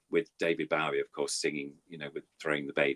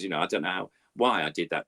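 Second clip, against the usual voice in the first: ordinary talk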